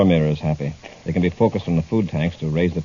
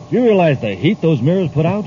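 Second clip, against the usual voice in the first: higher, louder appalled outburst; shocked protest; 8 semitones higher, 4 dB louder than usual. Acted. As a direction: object in disbelief at the plan. Horrified at deadly heat plan.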